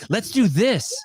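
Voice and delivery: high, gushing over-the-top enthusiasm; hyped executive. Pretend. Mocking a Coca-Cola exec's team-building trip, giddy high gushing. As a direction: play a corporate exec raving about it.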